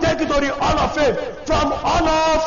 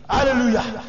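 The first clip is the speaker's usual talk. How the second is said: ordinary talk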